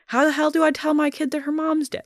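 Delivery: high-pitched imagined plea; imitated anguished dilemma. Voices dad's dilemma on the news.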